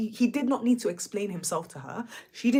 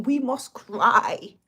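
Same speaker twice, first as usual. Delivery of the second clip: each word slow and weighty, louder; slow emphatic; 6 dB louder, much slower than usual. Shown as a demonstration. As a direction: lay it down like a commandment, slowly.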